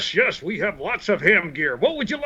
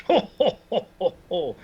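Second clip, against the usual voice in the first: Santa laugh. Acted.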